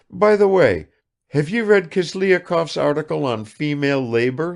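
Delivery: high, rapid-fire chatter of a vain lady; affected negligence. A narrator's reading. Kukshina showers Bazarov with questions, high and affected. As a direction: pour out questions without waiting. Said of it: "with affected negligence".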